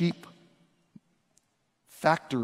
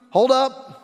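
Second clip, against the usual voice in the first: guard halting him; 7 semitones higher, 3 dB louder than usual. Acted. Acted temple guard stops worshipper.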